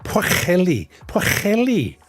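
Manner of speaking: Pwcheli with a throaty ch like in loch; careful Welsh attempt. Shown as a demonstration. Streamer retrying the Welsh double L. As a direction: sound out Pwcheli twice. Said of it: "The double L is a tricky one".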